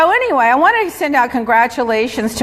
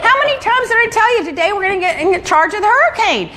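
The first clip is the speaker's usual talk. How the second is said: high-pitched, indignant mock yell; retold yelling; 10 semitones higher than usual. Boss retelling how she yelled at Paul over hurricane work, indignant. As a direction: scold him, pitch way up, without full volume. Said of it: "Then I started yelling at him".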